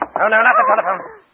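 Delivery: high, loud forceful no; sharp warning. Acted. Man stops the woman from phoning for help, loud and sharp. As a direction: snap at her to stop. Stops her reaching phone.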